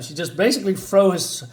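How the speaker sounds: ordinary talk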